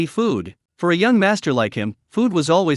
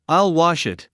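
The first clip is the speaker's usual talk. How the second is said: usual speaking voice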